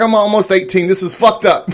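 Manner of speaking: put-on high, loud teen protest; son's protest. Son protesting to his father in the theater, high and loud. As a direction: play the indignant teenage son. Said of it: "He goes like".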